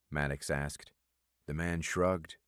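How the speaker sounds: plain reading voice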